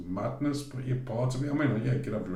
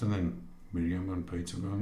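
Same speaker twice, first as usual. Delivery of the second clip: usual speaking voice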